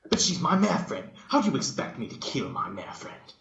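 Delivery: high, loud on my, then dropping; a touch of anguished protest. Acted. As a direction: protest in disbelief. Dash protesting.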